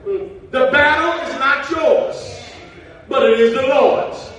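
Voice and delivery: loud, rising preacher's cry; preaching at full force. Acted. Preacher declares victory to the church, loud and rising.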